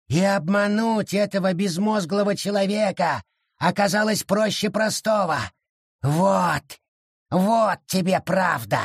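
high, cruel creature voice; spiteful gloat; gloating forest spirit. A narrator's reading. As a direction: twist the knife.

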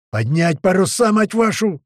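loud, drawn-out order climbing high; yelling. A narrator's reading. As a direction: bawl the command across the deck. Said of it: "снова орёт Туман".

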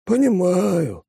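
mournful. Acted. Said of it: "скорбно покивал".

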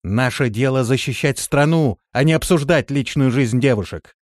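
commander's rebuke. A narrator's reading. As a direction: rebuke the troops with authority. Commander rebukes her gossiping troops. (властно, громко отчитывая)

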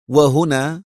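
usual speaking voice

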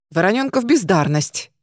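plain reading voice

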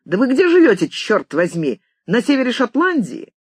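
high, exasperated questioning; piercing, angry. A narrator's reading. Writer asks where on earth George lives, shrilly angry. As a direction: swing up high in cross disbelief. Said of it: "пронзительно сердито закричал Макхарк".

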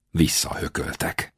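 plain reading voice

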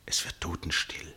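breathy hushed whisper. A narrator's reading. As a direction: whisper into the silence. Narrator at tense silence.

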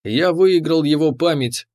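plain reading voice

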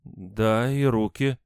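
rising to a high, puzzled echo; surprised. A narrator's reading. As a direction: confirm it, taken aback. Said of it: "удивлённо произнесла Надежда". (удивлённо)

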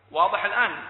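ordinary talk

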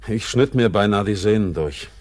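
lauter, heftiger Ausbruch; plötzlich laut herausgestoßen; laut aufbrausend. Acted. Bitter memory of cutting hand. (laut und heftig)

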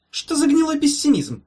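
high-pitched, emphatic doctor patter; breezy professor. A narrator's reading. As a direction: chivvy the patient along grandly. Professor falsely cheering the patient.

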